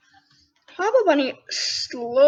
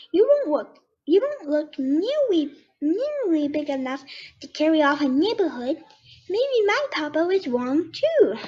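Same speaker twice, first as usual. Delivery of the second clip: higher, slow-spoken cub voice; young bear cub; 4 semitones higher than usual. Acted. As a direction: play the sizing-up bear cub.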